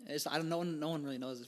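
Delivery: ordinary talk, nothing marked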